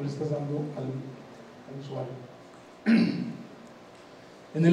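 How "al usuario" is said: ordinary talk, nothing marked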